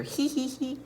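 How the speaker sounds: high, light princess giggle; tee-hee along as the giggling princess; storybook giggle. A narrator's reading. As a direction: pretend giggle, pitched well up. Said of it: "giggling here".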